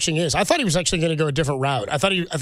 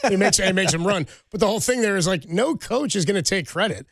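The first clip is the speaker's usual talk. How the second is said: usual speaking voice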